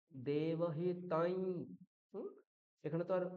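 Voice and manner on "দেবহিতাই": দেবহিতাই slowly, ending on a nasal i; slow, clear. Shown as a demonstration. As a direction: model দেবহিতাই slowly for the class. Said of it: "কি করে বলবো".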